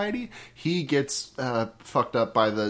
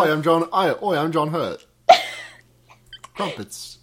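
hammed-up cockney British accent, lightly done; goofing as a British actor in a fake British accent; mock British accent; 3 semitones higher than usual. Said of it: "That's my British accent".

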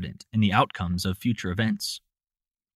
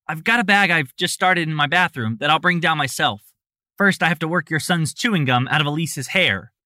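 annoyed; 6 semitones higher, 4 dB louder than usual. Acted. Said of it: "Nancy replied with an air of annoyance".

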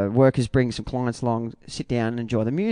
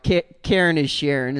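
usual speaking voice

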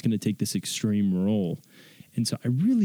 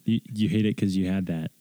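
ordinary talk

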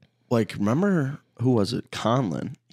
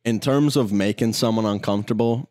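ordinary delivery

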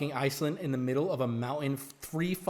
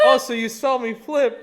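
louder, slow, laughing through it; slow laughing retort, louder; laughing mock question; 6 semitones higher, 11 dB louder than usual. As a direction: ask it while cracking up. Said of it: "And I go".

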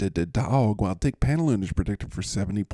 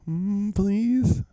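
high-pitched little "please"; playful pleading; 11 semitones higher than usual. Pretend. Co-host begging to ride the winning train, playful high pleading. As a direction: beg in a small, high voice.

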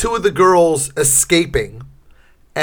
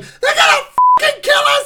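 shrill terrified shriek, much higher and fast; panicked scream; 3 dB louder, faster than usual. Retold or imitated. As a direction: scream like a girl running for her life. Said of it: "And, like".

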